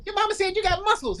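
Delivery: put-on high, impressed girl voice. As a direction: gush like a girl who's just heard gossip. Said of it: "then the girl say".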